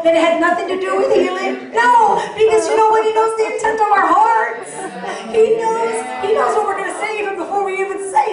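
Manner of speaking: high, loud, building preacher's cry; preaching at full force. Real, not acted. Preacher to the congregation, full-force preaching. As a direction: lift the room with rising conviction.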